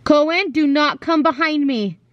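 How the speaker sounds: ordinary talk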